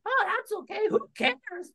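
very high puppet voice; Char Char puppet. Acted. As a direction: greet Magenta in a tiny high voice. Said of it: "Hey, Char Char".

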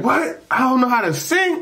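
high, offended "What?" rising; indignant disbelief. Retold or imitated. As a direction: scoff as if insulted. Cousin offended by challenge.

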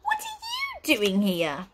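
high, drawn-out indignant question; shocked cry. A narrator's reading. Wizard confronts his unwelcome sister, shocked cry. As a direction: stretch it out in appalled disbelief. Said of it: "cried Flabbergast".

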